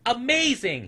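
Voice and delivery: thrilled amazement; amazed little snoodle. A narrator's reading. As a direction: gasp it out with delight. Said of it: "he said, with a gleam in his eye".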